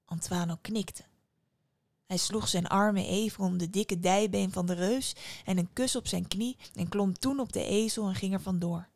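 ordinary delivery

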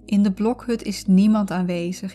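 normal talking voice

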